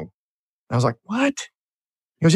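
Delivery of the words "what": high, soft, incredulous "what?"; retold disbelief. Business owner retelling his reply to his advisor's verdict, disbelieving.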